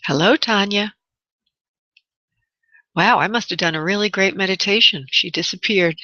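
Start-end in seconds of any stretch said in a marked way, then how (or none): none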